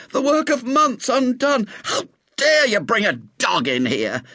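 high, horrified lament turning to scolding; gasping outrage. A narrator's reading. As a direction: splutter at the intruder in dismay. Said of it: "gasped the young man".

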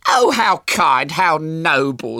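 high, loud, slow effusion; gushing mother. A narrator's reading. Mother praising the boys who found her child, gushing. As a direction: gush it out, stretching the words. Mother gushes over the rescuers.

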